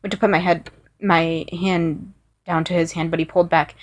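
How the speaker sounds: plain reading voice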